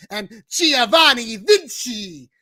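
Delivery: loud, slow ring-announcer flourish; ring announcer Samantha. Retold or imitated. Co-host copying how the announcer says it, loud announcing. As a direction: announce the name big and stretched out. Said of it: "I love the way Samantha says that".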